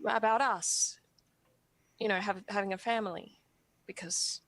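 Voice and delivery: halting, on the verge of tears; hesitant confession with long, emotional pauses; tearful, holding back. Acted. Asking for a blessing to have a family, tearful. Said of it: "I was really tearing up".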